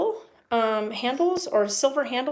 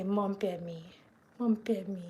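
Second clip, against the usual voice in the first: voicing the cat; 2 semitones lower, 5 dB quieter than usual.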